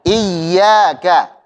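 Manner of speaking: "Iyyaka" slowly, with a pressed doubled ya; ya ditekan. Shown as a demonstration. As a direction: lean on the thin ya in "Iyyaka", long vowel after. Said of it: "Coba kita baca ya-nya ditasydidkan, ditekan". ("Iyyaka" pelan, ya tipis ditekan)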